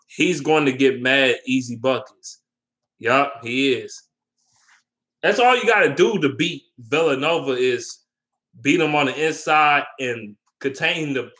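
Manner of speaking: ordinary talk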